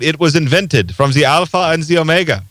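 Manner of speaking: bad German accent, quoting an archbishop; a light, hammy German accent. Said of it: "my not good German accent".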